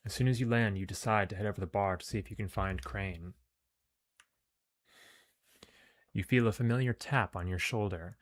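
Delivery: measured, projected story reading; story narration. A narrator's reading. Player reads the game's mission text aloud, narrating. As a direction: read it out like an adventure tale.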